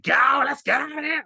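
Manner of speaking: high shouted Aussie bogan voice; bogan accent. Retold or imitated. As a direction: squawk it like an Aussie crow. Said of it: "the most bogan accent where he's like".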